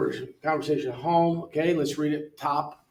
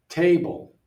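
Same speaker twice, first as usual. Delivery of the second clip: TAble, strong first syllable; first-syllable stress; 4 semitones higher, 6 dB louder than usual. Shown as a demonstration. Teacher models sorting by stress, loud first syllable on table. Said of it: "first syllable, second syllable".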